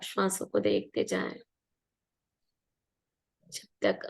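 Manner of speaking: unhurried meditation guide, long pauses between phrases; guided meditation. Real, not acted. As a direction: guide listeners gently into relaxation, leaving room to breathe.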